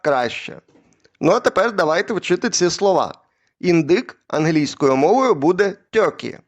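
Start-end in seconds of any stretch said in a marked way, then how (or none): none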